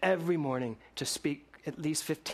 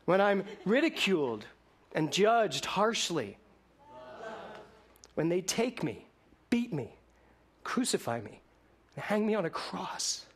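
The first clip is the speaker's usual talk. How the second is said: preaching litany; 5 semitones higher than usual. Acted. Litany of love in sermon.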